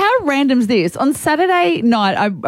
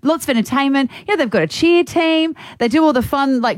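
normal talking voice

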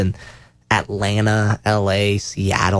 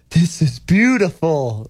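higher, slowed mock Scottish voice; attempted Scottish accent; 5 semitones higher, slower than usual. Scottish visitor admiring New York, in an attempted Scottish accent. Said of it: "I saw a Scottish person in New York. They're like".

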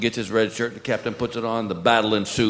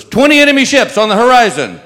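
higher, louder alarmed call; panicked lookout shout; 11 semitones higher, 10 dB louder than usual. Acted. Lookout in the crow's nest warns the captain, loud alarmed call. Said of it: "the guy in the crow's nest goes".

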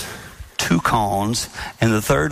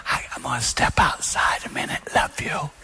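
whispered polite exit while upset; hushed, controlled excuse to a spouse; soft acted whisper; 4 dB quieter than usual. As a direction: whisper it, keeping the upset contained. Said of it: "Soft voice".

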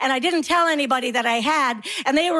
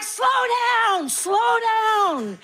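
drawn-out, high-pitched plea to slow down; calling out; 8 semitones higher, much slower than usual. Acted. Mother calling after running naked kids, calling out. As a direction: call after runaway kids, stretched and high.

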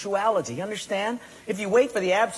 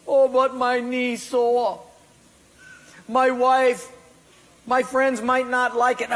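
mock whiny excuses; 6 semitones higher, 4 dB louder than usual. Believer's excuses mocked.

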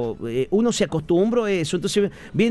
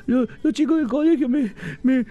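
put-on higher, stammering little kid's complaint; whining kid; 8 semitones higher than usual. A child complains to his father about bullies, whiny and higher. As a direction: play the bullied child, stumbling over the words. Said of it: "le decías a tu papá".

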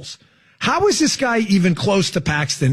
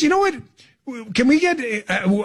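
ordinary talk

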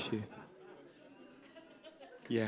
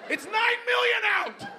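exasperated shout. Acted. Arguing back at complaint.

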